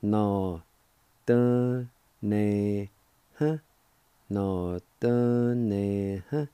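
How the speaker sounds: Na, dun, ne, huh given as separate beats with a lift on dun; syllable by syllable. Shown as a demonstration. Teacher sounding out the word twice for students, syllable by syllable. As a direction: tap out each syllable for the learners. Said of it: "is pronounced like this".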